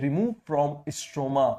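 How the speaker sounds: extremely slow, drawn-out words; slow dictation. Shown as a demonstration. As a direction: dictate each word slowly. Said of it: "कहां से remove कर रहा है".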